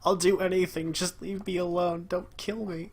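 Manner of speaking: high, pleading mock Heenan; a hint of a cowardly manager pleading; mock begging. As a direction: beg for your life, hammed-up. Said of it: "Heenan begging".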